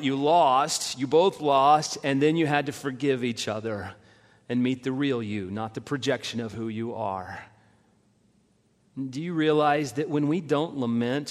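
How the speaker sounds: ordinary delivery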